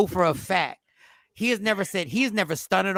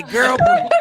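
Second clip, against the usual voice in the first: put-on laughing sassy girl please, higher; 4 semitones higher, 5 dB louder than usual. Grown man mocking himself, laughing, louder. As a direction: ham up the sass. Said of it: "a grown-ass man, say".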